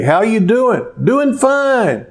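a hint of churchgoers faking they are fine; cheerful mask. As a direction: put on a bright front at church. Churchgoers wearing their masks.

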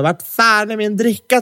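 put-on high, slow drunken yell at the crowd. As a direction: shout it like a drunk teen demanding a drink. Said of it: "Typ börjar skrika på människor".

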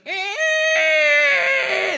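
shrill squeal at the chalkboard sound; high screech of disgust. Retold or imitated. Mimicking nails on a chalkboard with a loud high screech.

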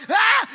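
loud, high comic scream; startled scream. Retold or imitated. Hiker startled by a deer, screaming. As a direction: reenact a shriek of fright.

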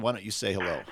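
ordinary talk, nothing marked

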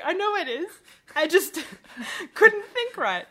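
high, loud, laughing protest; loud retold exclamation breaking into laughs; laughing outburst. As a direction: blurt it out through laughter, pitch soaring.

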